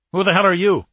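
loud, high demand; indignant challenge. A narrator's reading. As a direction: challenge a stranger loudly and sharply. Corporal challenges man who stopped truck.

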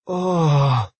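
long, drawn-out pleasured sigh. A narrator's reading. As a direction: let out a near-purring "oh".

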